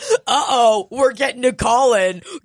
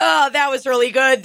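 ordinary delivery

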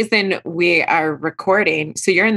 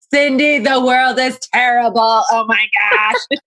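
hammed-up lament, raised and drawn out; theatrical complaint in a higher pitch; overdramatic venting; 4 semitones higher than usual. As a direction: pour out mock despair, stretching the last words. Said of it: "I'm like".

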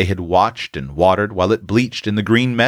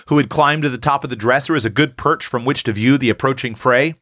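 ordinary delivery

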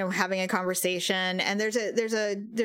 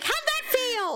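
much higher, teasing taunt; mock cheeky question. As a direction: playfully needle a celebrity. Imagined jab at celebrity.

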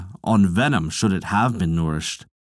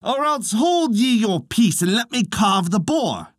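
high, ringing warrior's challenge; defiant challenge; 9 semitones higher than usual. A narrator's reading. Cecht challenging the Ulster heroes, high defiant call. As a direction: dare every man in the hall to match him. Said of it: "he cried".

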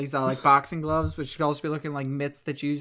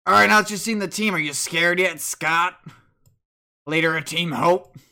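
louder, challenging villain voice; rival team leader; 8 dB louder than usual. Acted. Team leader taunts Scott after showing the team, loud challenge. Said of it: "the main leaders are back out, and he's like".

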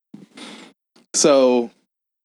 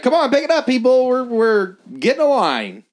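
mock pushy boss; 13 semitones higher than usual. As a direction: rush the staff like an annoying boss. Boss hurrying staff.

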